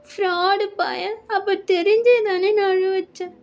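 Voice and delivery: put-on high, wounded girlfriend voice; hurt accusation. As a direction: accuse him, close to tears. Girl accuses him of causing tears.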